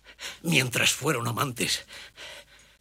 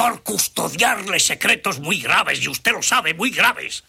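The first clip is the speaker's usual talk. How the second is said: angry; 7 semitones higher, 6 dB louder than usual. Acted. Servant threatening countess.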